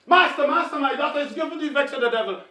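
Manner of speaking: loud, fast, urgent cry of Master, Master; loud pleading. Acted. A mother crying out to the master, loud and desperate. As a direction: beg him to save your daughter. Woman pleading again, louder.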